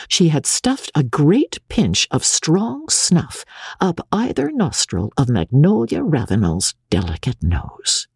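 ordinary delivery, nothing marked

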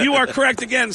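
high, triumphant quizmaster; game show host. Retold or imitated. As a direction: announce a win like a quiz host. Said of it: "let me just check the answers".

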